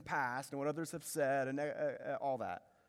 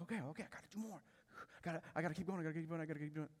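hushed, urgent self-talk; anxious inner voice; 7 dB quieter, much faster than usual. Retold or imitated. Preacher acting out his own thoughts, hushed and pressured. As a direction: murmur it to yourself, repeating under pressure. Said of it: "I thought that was my own mind".

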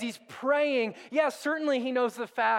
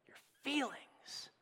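slow, breathy whisper on the last words; whispered aside; 4 semitones higher, 12 dB quieter, slower than usual. Acted. Pastor whispers the punchline of the train picture to the church. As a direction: drop to a whisper like a secret.

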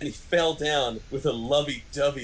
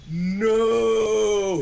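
higher and louder scream; mock scream; 7 semitones higher, 6 dB louder than usual. Reader acts the villain's dying scream, loud. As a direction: belt out a cartoon death cry. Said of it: "he screamed".

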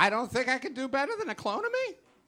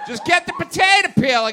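loud, higher barked order; angry shout; 6 semitones higher, 8 dB louder than usual. Acted. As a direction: yell the command across the garage. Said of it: "he sounded really angry and really upset".

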